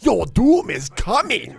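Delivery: anime voice. Shown as a demonstration. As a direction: boom it out like an anime villain. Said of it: "yours is somehow more offensive".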